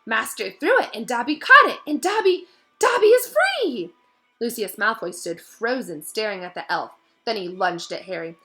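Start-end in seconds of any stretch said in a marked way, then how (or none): 0.1-3.9 much higher, louder, slowing to a cry; ecstatic elf. A narrator's reading. Dobby realises he is free, rising to joy. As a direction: build to a joyous shout.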